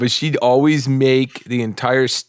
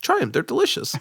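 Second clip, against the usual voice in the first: high, sing-song fake-sweet offering, much higher pitch; sly cookie pusher; 12 semitones higher than usual. Coworker pushing Gail's cookies on new kids, sweetly high mock voice. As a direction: coax the new kid like it's a treat.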